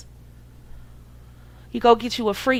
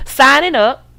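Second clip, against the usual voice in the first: louder, higher, with a laugh on the words; sardonic emphasis; 4 semitones higher, 5 dB louder than usual. Retold or imitated. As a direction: repeat it like a punchline. Mocking the women lining up.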